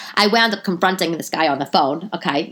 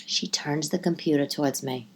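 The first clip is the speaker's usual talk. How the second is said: ordinary talk, nothing marked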